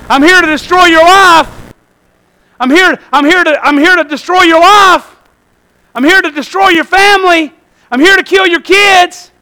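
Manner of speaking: high, loud mock introduction; devil's blunt announcement. Preacher playing an honest devil at the door, high blunt announcement. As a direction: announce the threat openly and emphatically.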